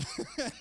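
high burst of laughter; real laugh. Real, not acted. Pastor laughing at his own nap story, amused high laugh.

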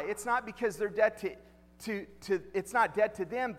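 usual speaking voice

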